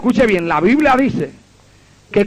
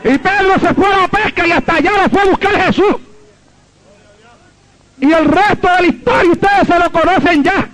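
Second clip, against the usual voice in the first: high-pitched pulpit proclamation; fervent preaching; 9 semitones higher than usual. Real, not acted. Preacher tells the church of Jesus seeking Peter, fervent high preaching. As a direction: preach it in a raised, insistent pitch.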